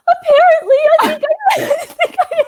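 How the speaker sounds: very high, laughing mock cry; hammed-up distressed squeal; mock wail. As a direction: wail helplessly, breaking into laughter. Retelling own helpless panicked reply.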